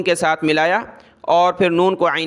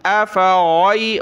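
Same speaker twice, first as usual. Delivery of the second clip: normal talking voice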